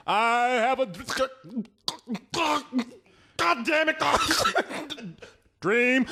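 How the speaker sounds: laughing stammer; stammering mock speech. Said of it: "that speech would have been like".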